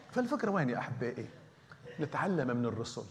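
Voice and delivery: ordinary talk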